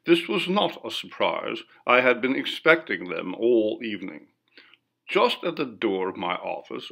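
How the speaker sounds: plain reading voice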